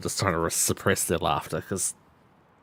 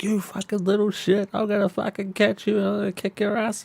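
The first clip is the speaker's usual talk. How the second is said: raised high pitch, hammed-up fury; mock furious receptionist; 13 semitones higher than usual. Receptionist chasing the skinny kid, hammed-up high fury. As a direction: play her threatening the kid, pitched high. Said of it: "she was like".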